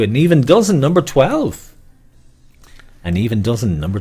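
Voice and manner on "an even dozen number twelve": higher, louder bingo call; bingo caller. Shown as a demonstration. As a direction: sing out the number to the hall. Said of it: "call repeated".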